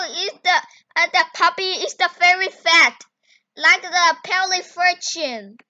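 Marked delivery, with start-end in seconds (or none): none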